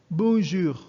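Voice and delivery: "bonjour" pronounced in French, model for the class. Shown as a demonstration. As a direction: say "bonjour" the French way, nasal bon, throat R. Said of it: "we pronounce it as".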